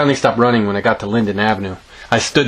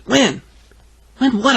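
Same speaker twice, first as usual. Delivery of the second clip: put-on high kid voices; 13 semitones higher than usual. Girl and boy quick exchange.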